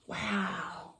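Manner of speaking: usual speaking voice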